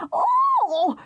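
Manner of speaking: very high breathy gasp of fright; frightened scream. Acted. Mother sees the crocodile speeding at her, shrieking. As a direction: shriek in sudden terror. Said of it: "she screamed".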